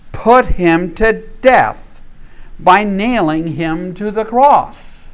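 loud, slowed accusation; slow grave preaching as Peter. Acted. Peter charging the crowd with the cross, slow and loud. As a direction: let each word land heavily.

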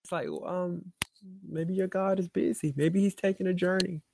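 understated mockery, voiced lightly; mocking. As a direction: taunt with pretend helpful suggestions. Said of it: "he's already started mocking the people".

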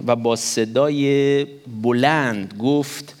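very slow, stretched reading with a rising end; badly read, drawn out. Shown as a demonstration. Preacher stumbling slowly through reading the verse aloud. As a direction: drag each word out as if stumbling through the verse.

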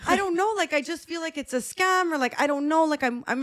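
high, flustered retold panic; imitating nervous self. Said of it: "I'm stressed. I'm nervous".

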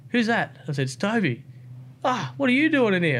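a hint of the surprised mate; mate's voice. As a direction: friend answering through the curtain. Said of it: "And then he's like".

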